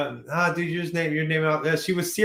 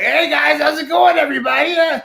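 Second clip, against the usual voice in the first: high shouty cartoon voice; impression of Adam; 10 semitones higher, 7 dB louder than usual. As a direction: yell it high and goofy. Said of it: "That's a terrible impression of you".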